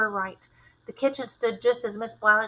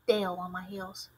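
ordinary delivery

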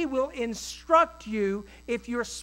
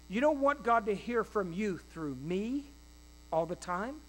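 ordinary talk, nothing marked

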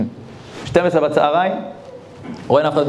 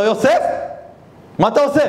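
surprised rebuke; 9 semitones higher than usual. Acted. Rabbi catches Yosef.

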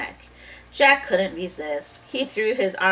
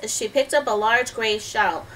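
ordinary delivery

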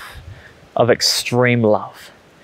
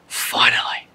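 unvoiced, breathed-out word of relief; hushed, acted relief; relieved sigh; 4 dB quieter, slower than usual. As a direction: let it out as a whispered sigh. Voicing relieved acceptance of invitation.

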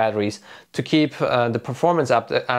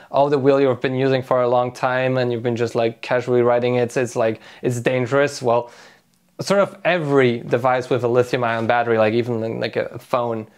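ordinary talk, nothing marked

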